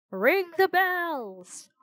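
high, slow call to ring the bells; a servant calling urgently, slowly. A narrator's reading.

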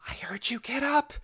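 high, hushed, breathless voice. A narrator's reading. Scargo limping hurriedly to Dune, breathless high voice. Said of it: "Scargo said breathlessly".

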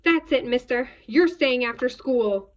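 loud, stern final warning; fed-up teacher telling off a pupil; scolding teacher. A narrator's reading. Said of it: "David's teacher always said".

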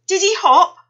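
high, loud eager question; excited squeal of a question. A narrator's reading. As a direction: ask bursting with hope. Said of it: "asked Missus Gray excitedly".

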